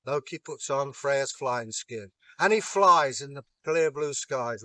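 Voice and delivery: ordinary delivery, nothing marked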